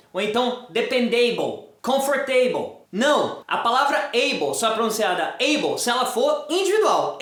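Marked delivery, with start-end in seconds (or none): 0.7-2.8 "dependable, comfortable" with full AY-bol endings; wrong pronunciation. Shown as a demonstration. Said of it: "pronunciam de forma errada".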